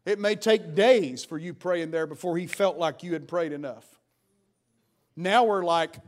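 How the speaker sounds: usual speaking voice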